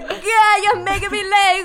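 high mock-Jamaican wail; hammed-up Jamaican lover in ecstasy; screaming Jamaican. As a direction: cry it out in a put-on Jamaican accent. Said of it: "he was, like, screaming".

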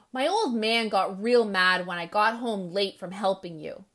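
usual speaking voice

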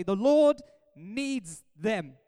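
very slow, weighty, drawn-out words; solemn scripture reading. Acted. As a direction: read the verse out slowly and firmly. First reading of the verse.

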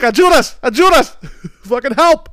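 high, loud hammed-up plea; mock cry for help. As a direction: yell for help like it's an emergency. Calling for help in Spanish.

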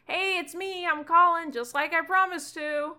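put-on high, loud kid voice; a nervous kid's voice pitched high and pushing loud; eager Gunther. Gunther calls Ewan on the phone, high loud kid voice. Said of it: "you hear Gunther goes".

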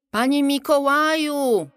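high, loud, drawn-out call. A narrator's reading. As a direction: stretch the name out as you shout for him. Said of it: "wołał Arbus".

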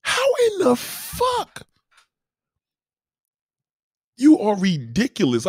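loud, high, slow exasperated yelling; slow high outraged rant at the TV. Retold or imitated. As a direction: yell at her in disbelief, dragging it out. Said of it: "I'm like".

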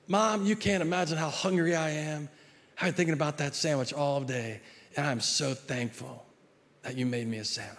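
butter up mom gently, all gratitude; sweet grateful kid. Acted. Grateful kid thanking mom, contrast.

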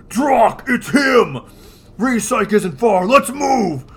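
loud, high, urgent cop command; gruff lawman voice. Acted. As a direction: bark the order to ride out. Dredd's lines read in voice.

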